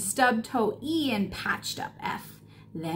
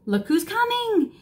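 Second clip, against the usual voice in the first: excited; 9 semitones higher than usual. A narrator's reading. Reading to kids, building excitement.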